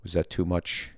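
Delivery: soft, low, hesitant questioning; lightly voiced teacher feeling someone out; tentative acting teacher. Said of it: "the acting teacher in the middle".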